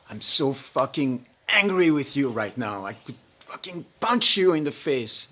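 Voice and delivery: loud, slow, seething threat; furious threat. Retold or imitated. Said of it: "she says something to you that sounds like".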